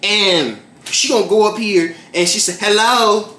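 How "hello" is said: mock impatient guest calling out; a lightly voiced older woman demanding service; impatient hello. Older guest claps at the server, a mock impatient hello. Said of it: "She clapped her hands like".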